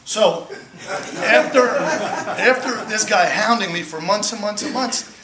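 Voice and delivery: real laughter telling the crowd; laughing. Real, not acted. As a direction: laugh through the buildup. Laughing, building the story.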